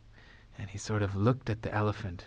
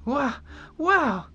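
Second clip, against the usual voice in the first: put-on excited boy; 13 semitones higher, 5 dB louder than usual. A narrator's reading.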